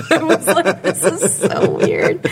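talking through laughter; laughing in disbelief. Real, not acted. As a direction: drag it out while laughing. Laughing at her own story.

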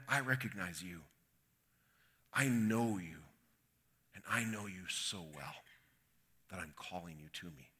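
soft, low and intimate; tender assurance. Acted. Jesus calling Nathanael to himself, tender and hushed. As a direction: drop low and quiet, letting each phrase settle.

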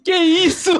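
high, loud laughing question; laughing disbelief. Real, not acted. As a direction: ask it while cracking up. Laughing at the joke.

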